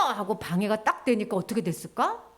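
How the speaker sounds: ordinary delivery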